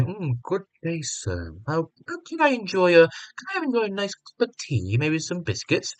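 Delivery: put-on a genteel tea-party posh British accent; hammed-up posh British accent. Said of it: "posh British, like".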